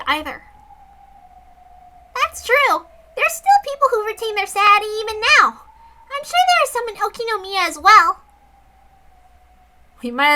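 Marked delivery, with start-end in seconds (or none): 2.1-8.2 put-on high, loud young girl. Girl character reassuring friends, high and bright.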